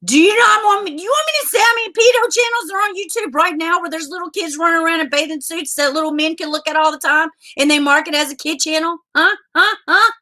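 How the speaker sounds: fast, high-pitched tirade; angry challenge. Real, not acted. Streamer confronting YouTube over its double standard, furious. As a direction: fire it off breathlessly, then demand an answer.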